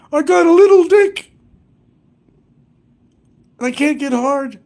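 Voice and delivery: mocking Barry. Mocking what Barry says about him, high loud taunt. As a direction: squeak it out to make fun of him. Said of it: "according to Barry".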